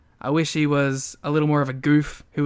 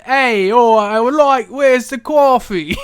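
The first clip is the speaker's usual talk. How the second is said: loud, higher hammed-up New York voice; mock New York accent; 5 semitones higher, 7 dB louder, slower than usual. Co-host as the wrestler answering a shopkeeper, loud bad New York accent. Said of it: "instead of a New York accent".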